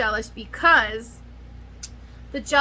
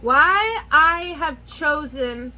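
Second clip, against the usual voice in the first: normal talking voice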